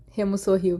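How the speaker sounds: usual speaking voice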